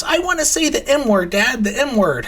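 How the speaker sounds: put-on high-pitched little-girl pleading; put-on kid voice, pitched right up; imitated young daughter. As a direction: play a small kid begging Dad. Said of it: "she goes".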